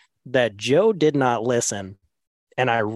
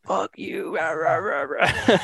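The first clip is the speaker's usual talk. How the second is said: higher mock angry grumble; angry grumbling friend; 6 semitones higher, slower than usual. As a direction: grumble back at the teasing friend. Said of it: "And he's like".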